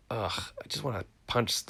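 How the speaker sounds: usual speaking voice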